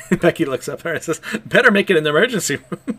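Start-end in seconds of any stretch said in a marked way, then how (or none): 1.4-3.0 laughing through the line, higher and louder. Retold or imitated. Becky jokes to the bleeding Yor, laughing. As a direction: crack up while delivering the joke.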